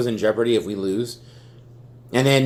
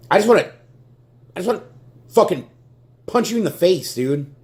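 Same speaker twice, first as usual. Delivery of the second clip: angry outburst; 8 semitones higher than usual. Real, not acted. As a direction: let the rage burst out at him. Furious fan rant at coach.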